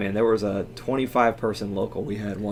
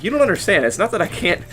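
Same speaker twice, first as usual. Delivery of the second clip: exasperated; 6 semitones higher, 7 dB louder than usual. Retold or imitated. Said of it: "And I'm like".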